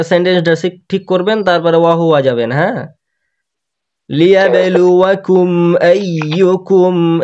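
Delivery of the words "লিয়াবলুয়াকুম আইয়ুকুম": লিয়াবলুয়াকুম said slowly, each syllable clear; slow careful recitation. Shown as a demonstration. Teacher showing how to say লিয়াবলুয়াকুম. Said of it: "এভাবে বলেন".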